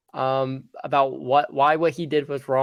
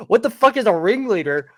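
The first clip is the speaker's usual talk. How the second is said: loud, higher-pitched baffled question; incredulous outburst; 6 semitones higher, 5 dB louder than usual. Retold or imitated. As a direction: blurt it out in comic disbelief. Baffled reaction to being told ringleader.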